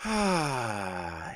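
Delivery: long breathy exhale; soft, voiceless falling sigh. Real, not acted. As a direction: let out a drawn-out, weary sigh. Exhales after mocking the hype.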